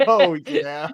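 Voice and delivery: loud laugh then a word; laughing hard. Real, not acted. Remembering the German villain voice.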